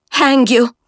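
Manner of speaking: put-on defeated girl snarling at Jemima; snarled. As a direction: bite off the curse. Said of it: "snarled Francis".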